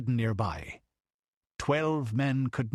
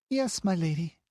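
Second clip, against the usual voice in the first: put-on much higher child's reply; murmur; 8 semitones higher than usual. A narrator's reading. The child answering her lady, higher small girl's voice. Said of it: "murmured Tina".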